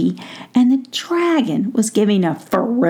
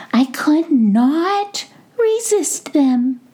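high, slow, squeaky little mouse; a flattering high mouse voice, drawn out; 12 semitones higher, slower than usual. Acted. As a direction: sweet-talk like a tiny mouse caught stealing. Little mouse pleads from tree.

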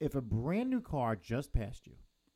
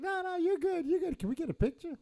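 much higher, eager and giddy; gushing fan. Retold or imitated. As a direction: fawn over the celebrity, voice way up. Cop gushing at [name].

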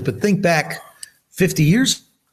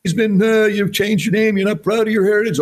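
teasing buddies; 7 semitones higher than usual. Retold or imitated. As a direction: ham up the guys razzing him. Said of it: "everybody would give me all kinds of crap".

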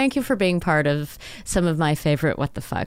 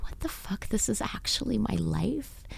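hushed voiceless awed whisper; 2 semitones higher, 10 dB quieter than usual. Retold or imitated. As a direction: whisper it in disbelief. Whispered inner voice quote.

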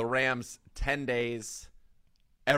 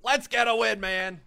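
loud, higher hype-man coach voice; fired-up coach; 8 semitones higher, 9 dB louder than usual. Pretend. Mock coach voice, hyping his team to get a win. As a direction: pump up the locker room.

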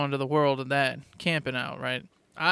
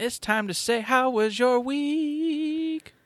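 show announcer; 7 semitones higher, slower than usual. Acted. Segment title announcement.

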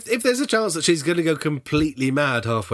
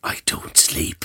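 whisper; slower than usual. Acted. Acted race walker secret.

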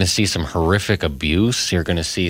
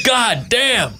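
much higher exclaimed curse; announcer swearing. Pretend. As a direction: blurt it out on air. Mock announcer reacting to hit.

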